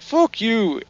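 high-pitched retold comeback; acted quarrel, voice jumping up; mock indignant retort. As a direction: fire back in mock offence. Acted quarrel, angry reply.

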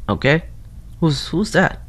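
ordinary talk, nothing marked

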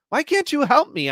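high, loud strained cry; helpless heart patient croaking for help, high and loud; croaked plea. Acted. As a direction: croak it out in desperation. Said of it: "I croaked out".

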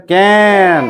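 ক্যান stretched on an open "ae"; jophola-akar vowel. Shown as a demonstration. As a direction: hold the vowel in ক্যান long. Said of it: "এটা কেমন উচ্চারণ করবো".

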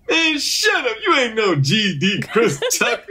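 high-pitched mock heckler through laughter; heckler's put-down. Audience guy shutting down the impersonation, mock indignation. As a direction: imitate an indignant audience member, amused.

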